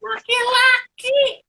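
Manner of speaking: put-on very high, slow child voice; a little boy proudly squeaking his words; high puppet kid voice. Shown as a demonstration. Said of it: "suara anak kecil".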